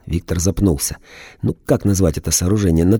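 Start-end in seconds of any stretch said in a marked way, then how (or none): none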